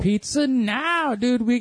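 high, slow, drawn-out teasing echo; mock high echo. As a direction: stretch it up high to tease. Mocking echo of partner's words.

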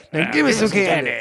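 high, goofy put-on voice; Crazy Under the Desk guy. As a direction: squeak it like a weird hidden guy. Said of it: "I'm Crazy Under the Desk guy".

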